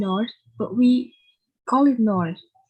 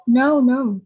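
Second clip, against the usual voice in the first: louder, quick denial; protesting; 2 semitones higher, 6 dB louder than usual. Acted. Denying to the stranger he minds, protesting. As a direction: insist politely that it's fine.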